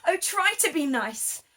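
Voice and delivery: mock-frightened lion whimper. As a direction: beg not to be hurt. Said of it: "he whimpered".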